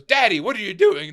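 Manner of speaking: imitating an excited child; young son. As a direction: call out suddenly like a little boy to Dad. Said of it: "my son kicks open that cabinet and goes".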